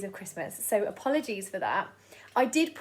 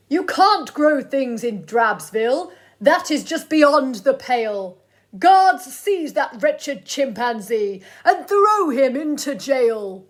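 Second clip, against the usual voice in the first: pompous mayor; 2 semitones higher, 9 dB louder, slower than usual. Acted. Mayor continues ranting.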